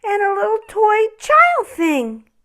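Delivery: very high, hushed amazement; wondering ornament. Acted. Broken ornament Noel discovers the stable, high and amazed. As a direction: marvel at what you finally see. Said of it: "he said".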